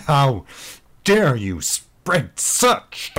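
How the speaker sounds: high, slow, faltering outrage; stuttering, stumbling. Acted. Mike caught out before his troops, high and stumbling. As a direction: stumble over the words in shock. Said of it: "stuttering and stumbling over his reply".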